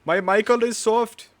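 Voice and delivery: put-on high, loud Indian accent like a scam caller. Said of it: "that was Indian".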